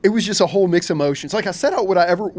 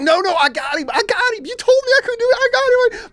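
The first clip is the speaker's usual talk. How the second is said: much higher, frantic triumphant shouting; shouting to dad he hooked the shark; carrying on excitedly; 3 dB louder than usual. Retold or imitated. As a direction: crow it like you can't believe it. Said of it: "I'm carrying on so much".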